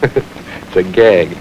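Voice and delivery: slow, amused disbelief; laughing it off. Acted. Suspect to the detective, insisting it's a prank with a laugh.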